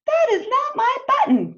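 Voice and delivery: high, loud cry of dismay. Acted. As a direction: Toad cries out, upset. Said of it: "cried Toad".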